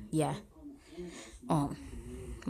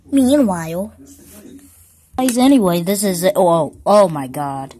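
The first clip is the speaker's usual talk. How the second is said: startled shouting; 11 dB louder than usual. Real, not acted. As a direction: blurt it out in a fright. Startled by game event.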